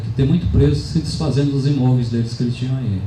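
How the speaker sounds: normal talking voice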